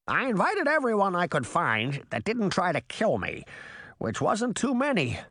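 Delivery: high-pitched crow character, worn out; squawking crow. A narrator's reading. Exhausted crow addresses the gathered crowd, high squawk. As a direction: squawk it high like a tired bird. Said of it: "the exhausted crow squawked".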